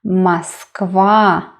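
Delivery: Moskva said "mas-KVA", long final A; weight the end of Moskva, A in the first syllable; stressed last syllable. Shown as a demonstration. Teacher repeats the city name, Moskva stressed on KVA. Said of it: "the last syllable is stressed".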